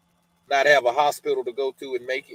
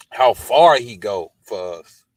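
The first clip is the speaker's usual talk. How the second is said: slow, louder, moved by feeling; real emotion, slowed and louder; emotional; 3 semitones lower, 8 dB louder, slower than usual. Real, not acted. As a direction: let the feeling press the words out slowly. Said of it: "it get me emotional".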